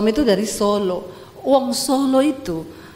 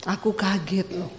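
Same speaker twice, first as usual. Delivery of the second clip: soft, subdued complaint; startled husband; 3 semitones lower, 8 dB quieter than usual. Acted. Husband complaining to noisy wife, soft and startled. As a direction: say it much softer, mock-wounded. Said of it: "Dia teriak".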